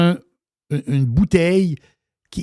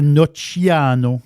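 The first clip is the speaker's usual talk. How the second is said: slow Italian pronunciation of the town name; Italian accent; much slower than usual. Shown as a demonstration. Host showing off the town's name, Italian accent. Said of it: "Pas pire mon accent, euh, italien".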